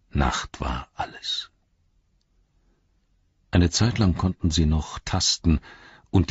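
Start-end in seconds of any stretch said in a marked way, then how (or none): 0.1-1.5 slow, hushed whisper. A narrator's reading. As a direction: breathe it out, barely voiced. Narrator's hushed ominous line.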